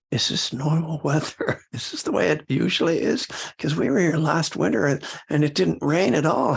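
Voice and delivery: mock bewildered neighbour, pitched high; puzzled newcomer. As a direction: imitate a baffled newcomer's high-pitched questions. Puzzled new neighbour, quoted asking.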